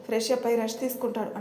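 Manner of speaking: ordinary delivery, nothing marked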